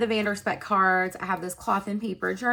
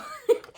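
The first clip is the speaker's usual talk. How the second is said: giggle. Real, not acted. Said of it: "A lot of silliness is coming out of me".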